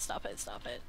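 desperate mutter at the boss; low muttered plea. Real, not acted. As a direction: plead under your breath. Pleading at game boss.

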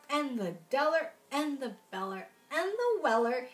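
bouncy rhyming list; storybook reading. Acted. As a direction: roll through the rhyming names. Reading Seuss verse to children.

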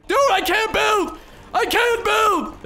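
high, loud, drawn-out yell; panicked shout. Real, not acted. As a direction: scream helplessly mid-fight. Cannot place blocks under attack.

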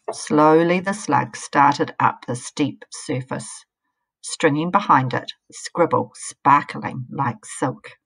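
put-on lively read-aloud for children; story narration. A narrator's reading. Reading a picture book to children, lively storytelling. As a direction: tell the tale to young listeners.

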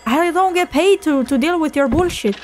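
high, sassy mock retort; sassy comeback. Clerk retorting to a rude customer, sassy high mock voice. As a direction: snap back dismissively in a high voice. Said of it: "And I'm like".

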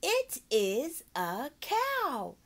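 "It is a cow" very slowly, word by word; drawn-out "It is a cow"; slow model sentence. Shown as a demonstration. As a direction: spell the sentence out slowly for a child. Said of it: "Sometimes you gotta break it up".

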